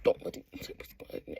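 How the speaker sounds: flustered hushed whisper; panicked whisper. Retold or imitated. Kip shocked Scott calls him, panicked whisper. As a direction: whisper like you're caught off guard. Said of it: "Kip is like".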